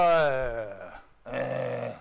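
low, drawn-out dismissive "ehhh"; mocking "eh". Preacher imitating people who mock the message, dismissive. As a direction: imitate a scoffer's long, low groan. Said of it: "gonna mock the message and go".

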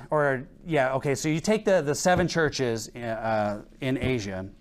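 usual speaking voice

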